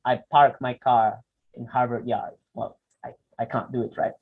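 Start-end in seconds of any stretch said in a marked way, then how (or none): 0.1-2.4 "I park my car in Harvard Yard" with the R voiced in "park", "car", "Yard"; American English hard R; American English rolled-in R; rhotic American English. Shown as a demonstration. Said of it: "the more American one".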